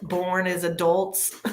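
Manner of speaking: ordinary talk, nothing marked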